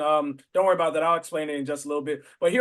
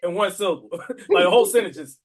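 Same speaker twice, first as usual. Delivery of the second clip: laughing; 5 dB louder than usual. Real, not acted. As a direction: talk through real laughter, a bit louder. Laughing while explaining.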